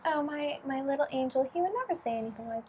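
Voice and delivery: soft, fast, doting mother; mother insisting her angel never swears; doting mom. Retold or imitated. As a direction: dismiss it like a blind, doting mom. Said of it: "Mom is like".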